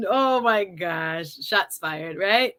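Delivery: ordinary talk, nothing marked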